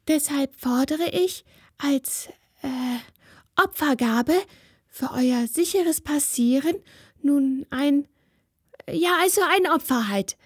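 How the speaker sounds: put-on a little girl trying to sound solemn and fumbling; nervous spirit girl. A narrator's reading.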